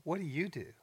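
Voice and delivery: mock-condescending retelling. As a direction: ask it as if talking down to someone. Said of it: "he spoke condescendingly to me".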